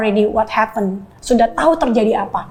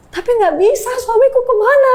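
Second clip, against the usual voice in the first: desperate plea; 13 semitones higher than usual. Retold or imitated. Wife pleading with her mentors about her missing husband, high and panicked. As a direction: voice rising in panic as she begs.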